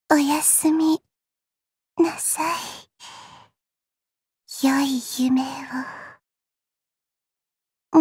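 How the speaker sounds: soft whisper, fading; whispered goodnight. Acted. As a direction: lull them to sleep in a whisper. Goodnight to sisters. (囁くおやすみ)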